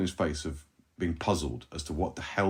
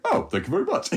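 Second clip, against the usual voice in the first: much higher, touched and delighted thanks; flattered driver; 12 semitones higher than usual. Retold or imitated. Driver thanks the old Frenchman for his compliment, higher and delighted. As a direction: play the grumpy driver suddenly charmed. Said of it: "he literally went".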